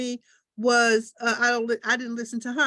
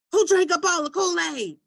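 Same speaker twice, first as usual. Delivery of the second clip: a hint of a fussing mother, higher pitch; mock scolding mom; 6 semitones higher, 3 dB louder than usual. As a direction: lightly play mama complaining. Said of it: "That's how it sounded".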